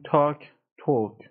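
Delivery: تاک with long A, then توک with long O; long vowel contrast. Shown as a demonstration.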